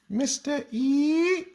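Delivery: mock childlike lilt going way up; imitating the kids' sing-song. Teacher mimics the class copying her, very high sing-song. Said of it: "I don't know why they go up like that".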